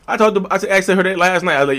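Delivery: ordinary talk, nothing marked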